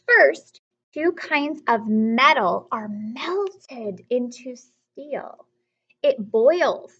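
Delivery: slow, careful storybook read-aloud; teacher reading the page to the class, slow and clear; reading aloud to children. Acted. Teacher reading a ship book to students, slowly.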